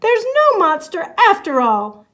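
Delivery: loud, happy cry; excited relief. Acted. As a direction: shout it with joyful relief.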